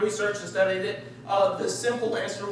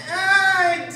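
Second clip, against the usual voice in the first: high, louder, laughing hesitation; hesitant dad; 8 semitones higher, 6 dB louder, much slower than usual. Retold or imitated. Friend Jay, dad of three boys, unsure about the roof, laughing hesitation. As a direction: hem and haw like an unsure dad. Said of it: "would be like".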